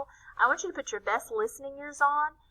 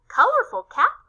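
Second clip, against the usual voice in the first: calling out; 13 semitones higher, 9 dB louder than usual. A narrator's reading. Classmate calling to Corky.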